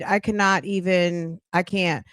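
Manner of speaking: usual speaking voice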